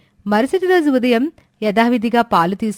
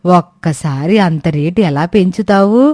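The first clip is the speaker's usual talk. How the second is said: put-on lower-pitched old woman, complaining; old woman Suramma; 6 semitones lower, 4 dB louder than usual. Old woman scolding the late milk seller, lower grumbling voice. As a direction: grumble like an impatient old granny.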